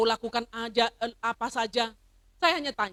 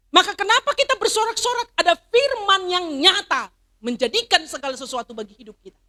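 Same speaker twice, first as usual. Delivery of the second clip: higher, louder rallying question to the church; fiery preaching; 8 semitones higher, 8 dB louder than usual. Acted. Pastor rousing the congregation with a loud, high question. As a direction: preach it out and lift the crowd.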